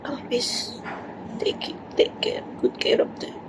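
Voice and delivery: hushed tearful whisper. Real, not acted. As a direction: whisper it through tears. Whispered advice.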